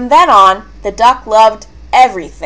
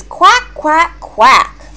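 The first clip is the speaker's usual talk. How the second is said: high quacks falling at the end; lightly voiced duck call; duck quacking; 6 semitones higher, much slower than usual. A narrator's reading. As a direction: quack with a complaining fall. Duck complains about mere quacking.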